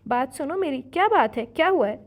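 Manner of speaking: worried shout, high and loud; sharp loud demand. A narrator's reading. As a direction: call hard down the phone to make her answer. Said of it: "تیز آواز میں بولا".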